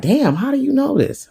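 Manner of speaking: high-pitched startled retort. Retold or imitated. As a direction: act out being caught off guard. Startled reaction to captain's warning.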